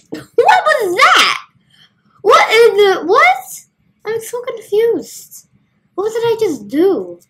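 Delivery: high, loud what-was-that; baffled squeak, up high; shocked and confused at the screen; startled confusion. Real, not acted. Confused by a glitch in game.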